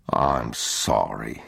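low, very slow and gentle; tender apology. A narrator's reading. Father squatting to hold his son, low tender apology. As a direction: apologize slowly, voice dropped.

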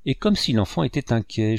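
usual speaking voice

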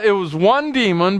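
ordinary delivery

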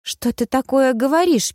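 Bridget interrupting. A narrator's reading. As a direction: cut in, shocked and high. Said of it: "перебила Бриджит". (высокий девичий голос, перебивает)

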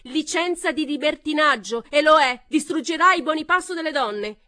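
very loud, high tirade; furious shout. A narrator's reading. Pamela shouting in the uproar, very loud. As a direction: roar it over them.

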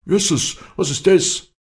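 high, loud, stunned exclamation; dazed cry. Acted. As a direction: cry out reeling from a blow. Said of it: "lallte er und sank auf die Bank". (benommener Aufschrei, hoch)

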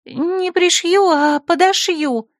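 put-on high, slow girlish correction; correcting herself. Secretary corrects her slip, high and slow. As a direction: fix the slip carefully, up high. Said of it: "исправилась Лира Грин".

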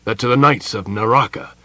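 loud, high, scornful retort; angry general's rebuttal; bitter, angry. A narrator's reading. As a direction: throw the claim back bitterly. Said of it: "gave a bitter, angry laugh".

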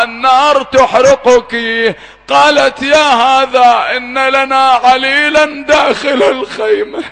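high, loud, wailing sermon narration; impassioned mourning sermon; preaching at full force. Acted. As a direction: wail the story to bring the hall to tears. Preacher retells the burning tents.

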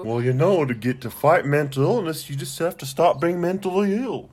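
a sarcastic Dr. Phil impression; mocking Doctor Phil. Mocking Doctor Phil's advice.

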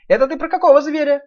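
high piglet question. Acted. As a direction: ask it high and bright. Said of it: "спросил Нуф-Нуф".

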